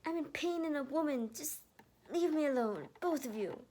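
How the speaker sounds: high, loud furious shriek. Acted. Erica shrieking at both men to leave her. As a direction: yell them away, hurt and furious. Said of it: "Erica shrieked".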